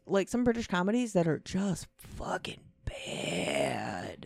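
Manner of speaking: hushed aside, breathy; whisper. Real, not acted. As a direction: drop to a whisper for the insult. Whispered aside about bad shows.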